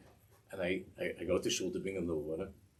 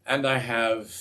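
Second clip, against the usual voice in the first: loud plea; 3 semitones higher, 10 dB louder, slower than usual. Retold or imitated. Poor man pleading his plight.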